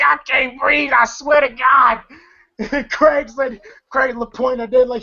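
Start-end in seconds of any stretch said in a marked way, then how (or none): 0.0-2.0 high-pitched imitation of a panicked yell; mock scream. Film fan imitating Day Day screaming he can't breathe, mock scream. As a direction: cry out as if being choked, played for comedy. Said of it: "Day Day screaming".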